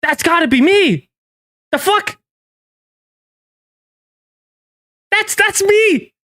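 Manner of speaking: real shock at being talked about; shocked disbelief. Real, not acted. Streamer realizing the leaked messages are about him, shocked high disbelief.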